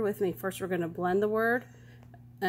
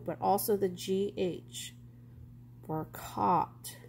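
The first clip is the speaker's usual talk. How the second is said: usual speaking voice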